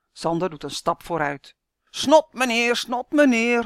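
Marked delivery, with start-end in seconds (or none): 1.9-3.6 louder, higher repeated taunt; cheeky shout. A narrator's reading. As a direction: shout it right at his face. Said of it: "roept hij". (brutaal schreeuwen)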